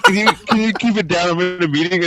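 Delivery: high mocking imitation. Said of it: "You were like".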